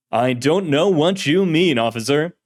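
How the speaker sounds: put-on grandiose old-time hero voice; radio serial hero. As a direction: declaim it like a pulp radio hero. Spectre voiced.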